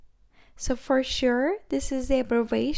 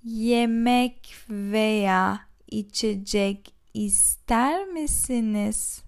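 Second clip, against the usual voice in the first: slow; 2 semitones lower, slower than usual. Shown as a demonstration. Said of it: "repeated slowly".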